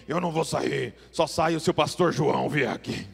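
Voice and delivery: low, eerie possessed growl; demon voice. Acted. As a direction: speak like the demon inside him. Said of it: "Gostaram da minha voz de demônio?". (voz de demônio)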